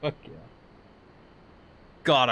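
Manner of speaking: usual speaking voice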